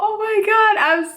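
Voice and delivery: very high excited oh my God; excited squeal. Real, not acted. Vlogger squealing about Candle Day.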